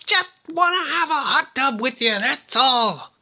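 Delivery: very high, strained comic plea; hammed-up desperate falsetto call; mock pleading. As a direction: whine it high like a rejected pursuer. Voicing Francis crying after escapees.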